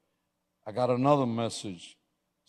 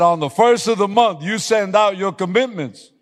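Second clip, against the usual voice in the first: booming God; 9 semitones higher, 11 dB louder than usual. Retold or imitated. God's reply raised.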